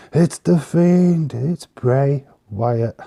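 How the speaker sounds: high, loud, drawn-out name announcement; dramatic reveal. Pretend. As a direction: announce the big return slowly and loudly.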